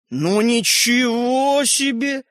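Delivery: very slow, drawn-out wow; dismayed exclamation. A narrator's reading. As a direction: stretch it out in dismay. Toy man dismayed.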